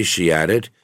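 plain reading voice

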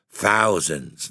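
plain reading voice

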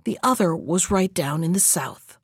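ordinary delivery

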